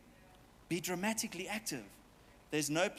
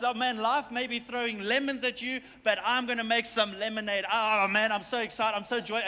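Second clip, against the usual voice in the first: louder, higher, bursting with joy; preacher acting out overflowing joy; joyful excitement; 4 semitones higher, 5 dB louder than usual. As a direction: sell the joy loudly to the congregation.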